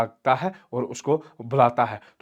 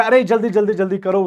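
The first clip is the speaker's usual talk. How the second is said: high, loud hurrying plea; acted panic, pushing for haste; urgent daughter; 7 semitones higher, 6 dB louder than usual. Daughter hurrying father to fetch suitor, frantic.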